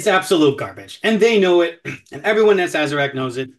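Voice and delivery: heated rant against the whiskey's makers. Real, not acted. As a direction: let the rant build. Ranting about unicorn whiskey.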